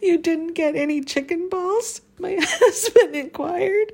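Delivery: words squeezed out between laughs; barely holding laughter in; laughing through words. Real, not acted. Still laughing while reading.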